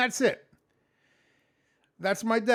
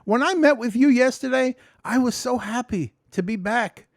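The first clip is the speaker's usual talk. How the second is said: loud, higher retelling to his supervisor; retold to supervisor; 6 semitones higher, 10 dB louder than usual. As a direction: belt it out like you're reliving it. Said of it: "I said".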